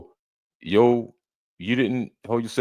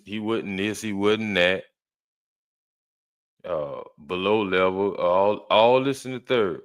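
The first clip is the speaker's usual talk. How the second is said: ordinary talk, nothing marked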